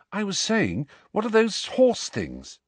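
Harry repeating. Acted.

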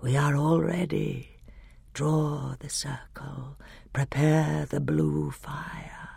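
slow, hushed, hollow order; grey voice. A narrator's reading. Grey voice preparing the ritual, hushed and slow. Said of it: "said the grey voice".

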